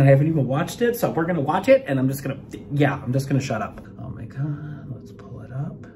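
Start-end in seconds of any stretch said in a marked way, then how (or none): 4.0-5.9 breathy excited whisper. Real, not acted. Fan whispering to viewers before pressing play. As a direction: hush it like a secret thrill.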